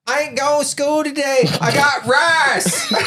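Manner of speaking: put-on high little girl voice with a Kentucky lisp. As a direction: lisp "rice" like a kid in Kentucky. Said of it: "I don't know if you call it a lisp".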